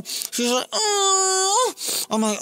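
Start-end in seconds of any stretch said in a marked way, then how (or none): 0.7-1.4 squeaky hammed-up moan; a silly high moan; high mock moan. Storyteller voicing the woman, a squeaky mock moan.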